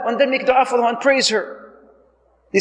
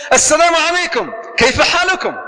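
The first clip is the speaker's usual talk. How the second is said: higher, lively salaam; hearty, raised-pitch hello to the household; acted homecoming greeting; 7 semitones higher, 3 dB louder than usual. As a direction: call out a greeting as you come through the door. Acted greeting on coming home.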